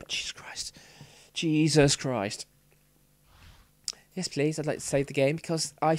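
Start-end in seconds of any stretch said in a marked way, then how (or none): 0.1-0.8 breathless relieved whisper. Real, not acted. Relief after saving game.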